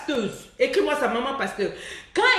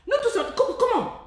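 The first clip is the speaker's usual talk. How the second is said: high, stunned comment; mock-horrified high pitch; shocked disbelief; 10 semitones higher than usual. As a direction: gasp at the shame. Incredulous outburst at women.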